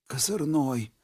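faint, barely audible whisper; dying whisper. A narrator's reading. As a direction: breathe the name out with the last strength. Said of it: "едва слышно прошептал умирающий".

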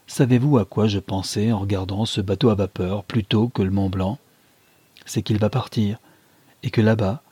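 usual speaking voice

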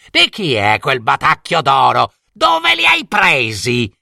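a touch of a high, scolding stepmother; furious stepmother. A narrator's reading. Stepmother berating Jelina for the dress, angry. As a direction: scold the girl sharply. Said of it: "si infuriò assai".

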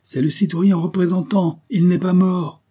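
high, loud exclamation; crying out. A narrator's reading. Soldier recognises the representative alive, crying out. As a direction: shout the news out to everyone. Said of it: "Une voix s'écria".

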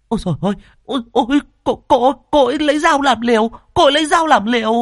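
high, panicked outcry of a kitchen woman; alarmed cry. A narrator's reading. Kitchen woman crying out as the girl grabs her knife, high panic.

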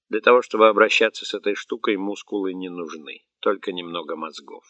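plain reading voice

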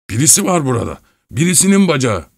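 high, startled exclamation; alarmed discovery. A narrator's reading. Husband finding someone's leg, startled. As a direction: blurt it out with rising pitch.

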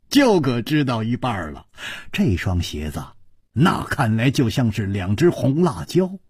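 ordinary delivery, nothing marked

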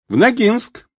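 put-on high-pitched girlish voice; ringing girl's voice. A narrator's reading. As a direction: answer high and clear like a girl. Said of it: "ответил звонкий девичий голос".

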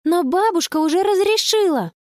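put-on high-pitched girlish counter-argument; insistent protest. Girl argues grandmother already allowed it, high insistent protest.

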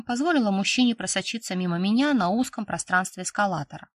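usual speaking voice

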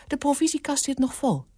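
high-pitched housekeeper's excuse; high, protesting woman's voice; objecting housekeeper. A narrator's reading. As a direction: wave it away in a high voice. Housekeeper waves away suggestion.

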